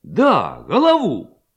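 emphatic confirmation. A narrator's reading. Levko confirms firmly.

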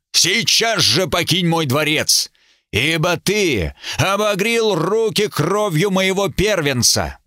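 high, enraged royal curse; a grieving king hurling curses; furious king. A narrator's reading.

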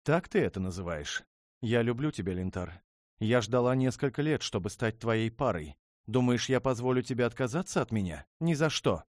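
high, loud, rushing outburst; furious pleading. Acted. Princess refuses to let her betrothed go, furious shout. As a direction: rage and love tumbling out. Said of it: "Ярость исказила".

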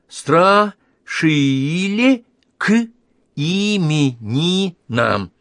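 slow, high, each syllable apart; reading syllable by syllable. Acted. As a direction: sound it out like a child learning to read. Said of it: "прочитала по складам". (по складам, медленно)

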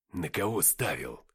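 low, slow character voice; low, deliberate questioning; victor's question. A narrator's reading. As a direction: tease a companion in a low, unhurried voice. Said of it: "спросил Деймос".

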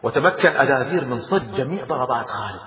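plain reading voice

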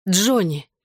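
plain reading voice

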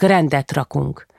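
ordinary delivery

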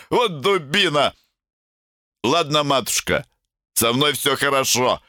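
put-on high, slurred drunk voice. A narrator's reading. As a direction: brush it off drunkenly. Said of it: "заплетающимся языком". (пьяно, заплетаясь)

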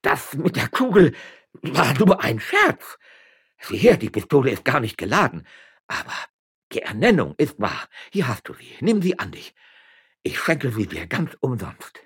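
loud, high-pitched nervous pleading; frightened backtracking. A narrator's reading. Magistrate at gunpoint offers the appointment, frightened pleading. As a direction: plead urgently to calm an armed woman.

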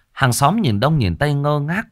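usual speaking voice

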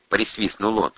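ordinary delivery

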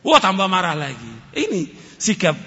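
usual speaking voice

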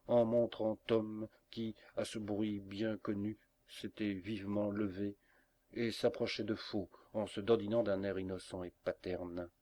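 ordinary delivery, nothing marked

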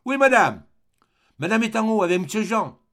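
high, loud servant's reply; maid calling from downstairs. A narrator's reading. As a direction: shout the answer up from the basement. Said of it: "La voix de la bonne".

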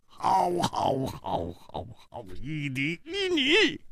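strained, broken string of assent; hoarse cry. Acted. Song Gang giving in after stamping his foot, hoarse. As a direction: start high, sink to a croak, then rise. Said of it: "嘶声说".

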